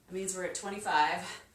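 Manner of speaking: usual speaking voice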